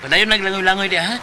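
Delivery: shouting to friends still swimming; calling out. Acted. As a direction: call out to the swimmers.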